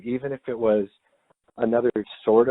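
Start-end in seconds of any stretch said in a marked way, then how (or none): none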